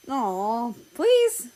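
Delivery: high, loud drawn-out begging; whiny pleading. Acted. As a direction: beg like a child. Begging for the dog.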